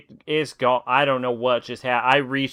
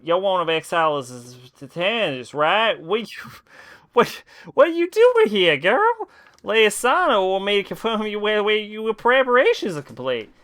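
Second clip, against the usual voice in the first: raised voice reading game lines; character voice; 7 semitones higher than usual. Acted. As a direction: act out the game dialogue. Reads game dialogue aloud in voices.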